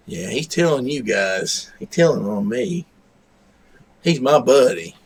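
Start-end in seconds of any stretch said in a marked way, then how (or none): none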